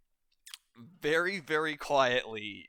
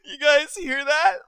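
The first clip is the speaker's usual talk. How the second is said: much higher and louder, crying with laughter; laughing hard; 9 dB louder than usual. Acted. Pirate lady mocking the word landlubbers, howling with laughter. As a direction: squeal it through helpless laughter. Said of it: "she's just losing it".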